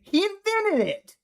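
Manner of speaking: high slow squeal of mock awe; mock-awed high exclamation; mock astonishment. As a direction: stretch it up high in fake amazement. Mock amazement at fighter.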